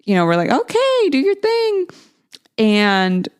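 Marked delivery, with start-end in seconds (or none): 0.5-1.9 put-on high coaxing voice for a toddler; sing-song encouragement. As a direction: coax a toddler sweetly.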